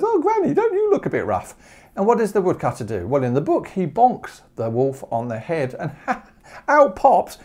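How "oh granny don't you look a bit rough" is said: put-on very high, silly little girl voice; dim girl voice. Girl mocked as silly, greeting the wolf very high. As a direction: say it like a clueless child.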